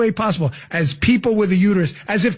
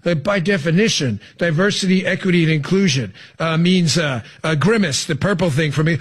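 mocking slow, lower; 5 semitones lower than usual. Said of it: "she thinks it makes her sound smart".

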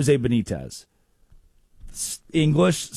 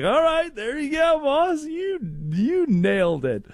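much higher, playful praise; mock hype; 10 semitones higher than usual. Host praising Jose's milf answer, high mock hype. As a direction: squeal it like a delighted fan.

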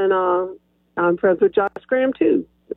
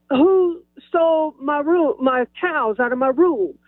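hammed-up outrage; 4 semitones higher than usual. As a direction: draw it out for comic indignation. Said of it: "I didn't say it quite like that".

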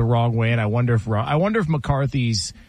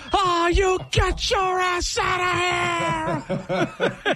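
high, slow laughing yell; furious dad yell; much slower than usual. Retold or imitated. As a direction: scream at the dog as the raging dad, laughing through it. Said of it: "Do it one more time".

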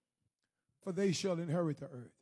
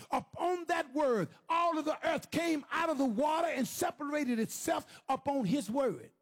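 high, driving sermon build to a shout; impassioned preacher, punching every phrase; full-force preaching; 5 semitones higher, 3 dB louder than usual. Real, not acted. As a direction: drive each phrase home to the congregation.

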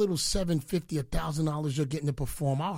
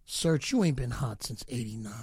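usual speaking voice

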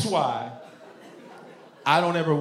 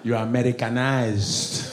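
put-on slow Nigerian-accented verdict; slow accusation with a Nigerian accent; disapproving Nigerian accent; 3 semitones lower than usual. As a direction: pass judgment in a Nigerian accent, drawn out. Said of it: "Even Nigerians be testing me".

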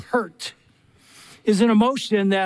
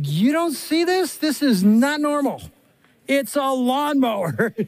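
slow, higher, drawn-out disbelief; incredulous wife; 4 semitones higher, slower than usual. Retold or imitated. Wife imitated staring at her husband over the lawnmower, slow incredulity. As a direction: stretch each word in mock disbelief. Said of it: "She was just looking at me like".

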